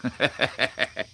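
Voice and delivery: a quick run of soft laughs; chuckle. Real, not acted. Amused by chat about tacos.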